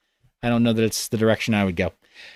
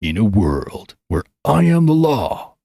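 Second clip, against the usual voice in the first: movie trailer voice; 2 semitones lower, slower than usual. Retold or imitated. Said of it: "I can't even do it".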